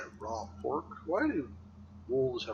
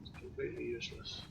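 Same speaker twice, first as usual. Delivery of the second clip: whispered aside; 8 dB quieter, faster than usual. Real, not acted.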